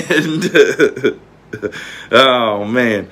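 laughing through the words, flustered; flustered laughter. Real, not acted. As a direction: break into real giggles, lost for words. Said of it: "I'm tongue-tied".

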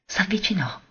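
hushed, unvoiced whisper, falling away; a secret step closer, barely voiced; breathless whisper. A narrator's reading. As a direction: breathe it out as if holding your breath. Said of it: "trattenendo il respiro".

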